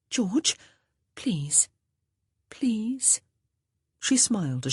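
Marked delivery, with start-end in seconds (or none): 0.1-0.6 higher, pleading, breaking into a whisper; pleading young woman. Acted. Honor begs George urgently. 2.5-3.2 please whispered, higher, rising. Acted. Honor pleads with George, barely whispering.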